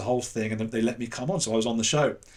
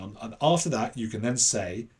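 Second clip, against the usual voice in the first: ordinary talk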